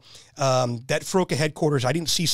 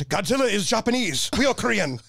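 imitating Korean accent; 6 semitones higher than usual. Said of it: "I said it like that".

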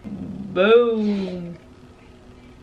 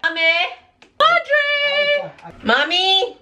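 calling out; 10 semitones higher, slower than usual. Real, not acted. Calling out for someone.

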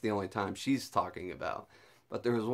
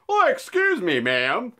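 loud call-out; 11 semitones higher, 9 dB louder than usual. Retold or imitated. Calling out to store employee.

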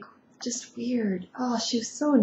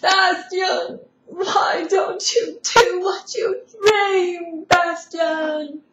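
emotional Empress; 6 semitones higher, slower than usual. Acted. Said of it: "She's all, like, emotional".

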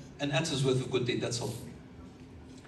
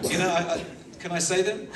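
talking through real laughter; words breaking into laughs; laughing; 3 semitones higher than usual. Real, not acted. The preacher laughs as the servant asks to confess.

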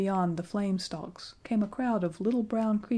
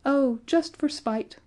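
high, slow, raised voice; careless bawl; 7 semitones higher, 4 dB louder than usual. A narrator's reading. The dwarfs answer George, bawling carelessly. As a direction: bawl it out offhandedly. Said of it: "bawled the dwarfs carelessly".

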